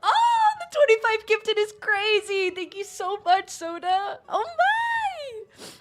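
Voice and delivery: high, loud squealing thanks, near tears; high excited shrieks breaking into laughs; overjoyed squeal. Real, not acted. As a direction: shriek with real delight, half crying. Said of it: "I'm crying".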